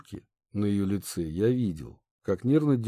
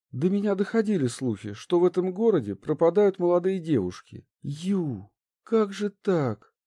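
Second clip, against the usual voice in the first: put-on higher, pressing young noblewoman; upset princess; 9 semitones higher than usual. A narrator's reading. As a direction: princess demanding answers.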